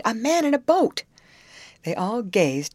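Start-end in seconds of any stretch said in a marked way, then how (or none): 0.0-1.0 higher and louder, eager cry; excited cry. A narrator's reading. Girl pointing upriver at a boat, excited shout. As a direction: call out the sighting with a lift. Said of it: "she cried".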